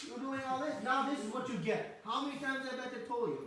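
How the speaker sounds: high, loud scolding of a naughty little brother; chastising. Retold or imitated. Balaram scolding tied-up Krishna for his mischief, loud high scolding. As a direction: scold him like an exasperated older brother. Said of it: "Balaram is chastising Krishna".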